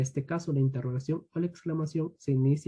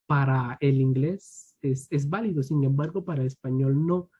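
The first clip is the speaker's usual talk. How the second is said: usual speaking voice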